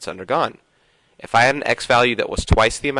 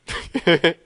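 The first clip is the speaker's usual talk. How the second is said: sudden helpless giggle; real amused laugh; 6 semitones higher than usual. Real, not acted. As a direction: burst into a giggle. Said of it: "I don't know why I'm laughing".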